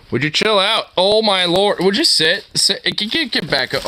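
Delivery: high-pitched scold at a jumpy dog. Real, not acted.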